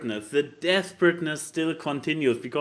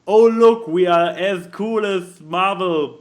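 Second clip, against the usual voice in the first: mock boasting; 8 dB louder, slower than usual. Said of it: "the attempt to be like".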